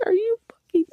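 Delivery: high, loud incredulous outburst; disbelief. Retold or imitated. Reader reacting to the book, high incredulous outburst. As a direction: blurt it out in shock. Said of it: "I was like".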